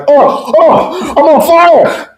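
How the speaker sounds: very high mock panic cries; badly faked alarm to get out of trouble; pretending to be on fire. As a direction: yelp it in comic falsetto panic. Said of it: "you pretend to be on fire".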